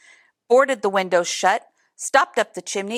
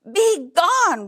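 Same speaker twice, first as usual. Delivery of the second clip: roaring bear; 8 semitones higher, 3 dB louder than usual. A narrator's reading. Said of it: "roared the bear".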